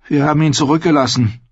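hohes, rasches, klägliches Gestehen; klägliches Geständnis. A narrator's reading. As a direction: gesteh es kläglich, mit höherer Stimme. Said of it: "gestand Gren kläglich". (kläglich, hoch und schnell gestanden)

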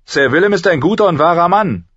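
high, loud, quick declaration; staunch knight. Acted. Kingsguard knight defending Sir Willem to Ned, staunch and loud. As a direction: vouch for him fast and forcefully.

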